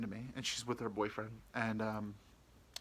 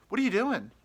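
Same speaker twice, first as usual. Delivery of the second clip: much higher, louder perky ask; her bright question; 11 semitones higher, 7 dB louder than usual. Retold or imitated. Woman texting the narrator, higher and perky. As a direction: sing it out like a flirty text.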